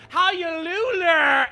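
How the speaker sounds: high, loud, very slow drawled question; Madea voice. Retold or imitated. Tyler Perry's character teasing the audience, loud slow drawl. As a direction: ham it up like the old lady character.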